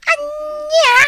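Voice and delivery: high, loud, near-crying disbelief; shocked. Acted. Wife hears he cut a dozen kids' hair, shocked. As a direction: gasp it out in dismay.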